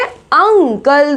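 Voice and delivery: अंकल very slowly, UNG-kal with short uh; drawn-out अंकल, uh sound first; slow careful pronunciation. Shown as a demonstration. Teacher models the u sound slowly, uh. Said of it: "किसका sound आ रहा है".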